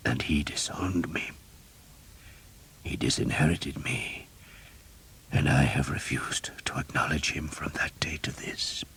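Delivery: a weak, breathy whisper of a dying man; hushed dying priest. A narrator's reading. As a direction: whisper it, barely any strength left.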